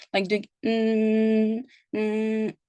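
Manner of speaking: slow closed-mouth mumbling hum. Shown as a demonstration. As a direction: hum an unclear mm, like a drowsy patient. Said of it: "this is the sound of mumbling".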